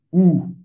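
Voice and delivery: U with lips rounded as for O while saying I; loud, clear French U for the learner; U francês, boca de O e falar I; French U sound. Shown as a demonstration. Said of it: "Para fazer esse U".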